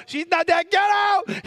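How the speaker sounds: high, loud retold yell; shouted order. Jesus at the girl's house, acted shout. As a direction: build into a bellowed command.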